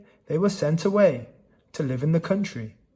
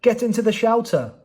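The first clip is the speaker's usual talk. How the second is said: yelled; 6 semitones higher than usual. A narrator's reading. Man yelling at children to take shelter, urgent. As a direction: shout them into safety. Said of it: "he yelled".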